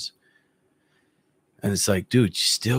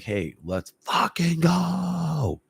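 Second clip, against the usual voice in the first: higher, stretched-out pump-up call; hype-up call; 7 semitones higher, much slower than usual. Retold or imitated. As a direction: drag it out to fire him up. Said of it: "where I'm like".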